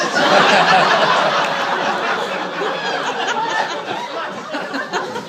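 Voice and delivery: high, long evil cackle; evil laugh. Acted. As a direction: devilish cackle after the line. Devilish laugh.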